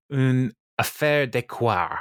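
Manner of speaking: slow, drawn-out attempt at a French accent. Shown as a demonstration. Podcaster retrying the French for a co-host, deliberate French accent. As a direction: put on a French accent for "affaire de coeur", stretching it out. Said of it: "Does that sound right?".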